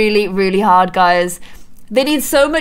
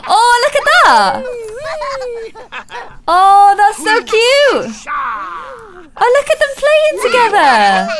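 high, louder gushing over cuteness; delighted cooing; 9 semitones higher, 4 dB louder than usual. Real, not acted. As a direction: gush like seeing a puppy. Dad plays with baby Sim.

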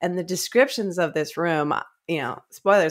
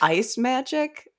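puzzled quote; 8 semitones higher, slower than usual. Retold or imitated. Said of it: "And they're like".

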